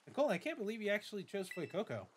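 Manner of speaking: lightly voiced eager rival girl; a touch of bubbly friendliness; Nemona. Nemona meets her new neighbor, lightly eager voice.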